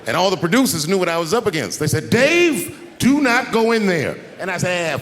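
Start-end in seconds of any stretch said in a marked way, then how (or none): 2.1-4.2 high, loud retold warning; urgent warning shout. Producers stopping comic from entering kitchen, urgent shout. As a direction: call out the name high, then slow, emphatic words. Producers warn him of the danger.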